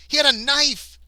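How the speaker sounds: high, loud wail; dismayed outburst. Real, not acted. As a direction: cry it out in frustration. Dismayed outburst over lost ally.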